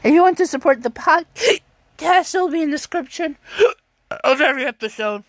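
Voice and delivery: rushed words between hiccups; talking through the hiccups. Real, not acted. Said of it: "I'm having the hiccups right now".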